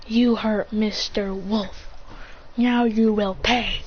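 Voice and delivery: slow, measured threat; acted vengeance, unhurried; menacing. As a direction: space out the words ominously. Vengeful threat with Byakugan eyes.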